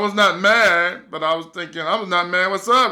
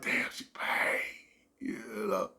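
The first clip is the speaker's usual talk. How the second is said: soft whispered thought; whispered daydream; 13 dB quieter than usual. Retold or imitated. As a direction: whisper it like a secret fantasy. Fantasizing to himself.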